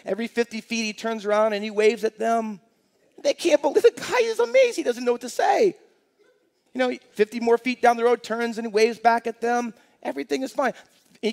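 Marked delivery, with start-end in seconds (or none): 3.2-5.7 high, excited, laughing narration; amazed. Real, not acted. Joke builds to amazed climax.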